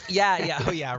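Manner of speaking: usual speaking voice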